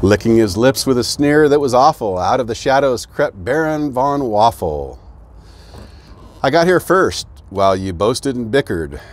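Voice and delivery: lively rhyming storybook read; bouncy picture-book narration; reading to children. A narrator's reading. Reads picture book aloud.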